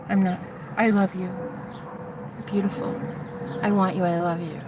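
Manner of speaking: soft, slow loving murmur; hushed, spaced-out tenderness; dazed adoration. Retold or imitated. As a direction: breathe out love, fading to near whisper. Reliving her dazed declarations of love.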